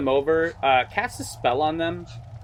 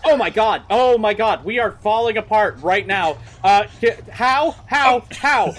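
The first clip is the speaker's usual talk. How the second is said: loud, high hysterical cries; panicked scream; 8 semitones higher, 7 dB louder than usual. Acted. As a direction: scream as if everything is collapsing around you. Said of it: "she screams like".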